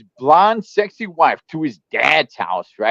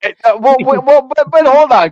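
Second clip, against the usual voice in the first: loud, raised protest; interrupting; 7 semitones higher, 6 dB louder, faster than usual. Real, not acted. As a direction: push in to stop him. Cutting in on a friend.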